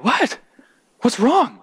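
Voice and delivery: high-pitched startled questioning; acted alarm. Friend answers the sobbing girl, acted alarm. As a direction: play a concerned friend, voice jumping up.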